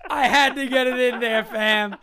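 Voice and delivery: loud, high, laughing delight; real gleeful triumph. Real, not acted. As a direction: crow over a joke that landed. Delighted at landing his joke.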